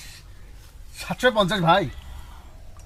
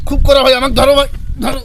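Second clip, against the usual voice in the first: put-on drunk shout; 8 semitones higher, 9 dB louder, faster than usual. Strong liquor hits him.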